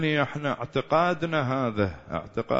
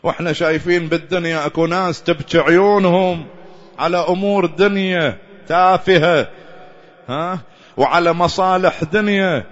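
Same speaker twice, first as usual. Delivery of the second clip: passionate preaching; 3 semitones higher, 5 dB louder than usual. Acted. Preacher on worthy tears.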